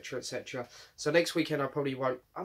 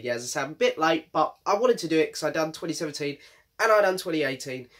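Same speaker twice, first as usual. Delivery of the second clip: ordinary talk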